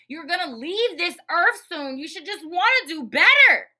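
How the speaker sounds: building to a high, loud yell; emphatic exhortation. Real, not acted. Host urging listeners to do better, rising to a yell.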